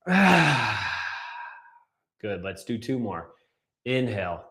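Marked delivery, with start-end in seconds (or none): 0.2-1.5 long audible sigh of release; exhale sigh. Shown as a demonstration. Coach shows the class how to release a big breath, long exhale sigh. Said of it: "And release".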